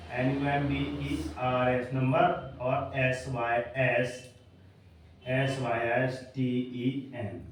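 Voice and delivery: each letter of number and system said slowly and apart; slow spelling. Shown as a demonstration. Teacher spelling the words for students. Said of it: "ये ऊपर spelling लिखी है".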